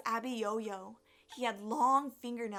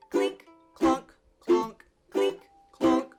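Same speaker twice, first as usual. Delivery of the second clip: loud, punchy clinks and clonks; ukulele plucking noises; 2 semitones higher, 12 dB louder, slower than usual. Acted. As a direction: imitate plucked strings, each note hit hard. Imitates the boy's ukulele plucking.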